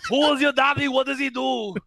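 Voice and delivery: shouted mock Austrian Gunther; mock Austrian accent. To the co-hosts, shouting Gunther's lines in a mock Austrian accent. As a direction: bellow as the ring general. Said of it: "Just think of Arnold Schwarzenegger".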